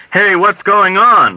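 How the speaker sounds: loud, high, drawn-out plea; scared man's high, slow cry; frantic pleading. A narrator's reading. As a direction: plead loudly to be told. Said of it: "Hungry Joe pleaded".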